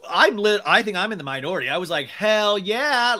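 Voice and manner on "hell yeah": higher, louder retold cheer; enthusiastic approval. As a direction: cheer it as your gut reaction. Enthusiastic reaction to the chart.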